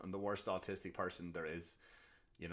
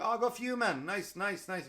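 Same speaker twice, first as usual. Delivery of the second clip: high, bright-eyed mock cheer; mock enthusiastic; 10 semitones higher, 4 dB louder than usual. Coworkers fake-cheering a customer's birthday, high mock enthusiasm. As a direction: gush over it like a fake-friendly coworker. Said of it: "we were like".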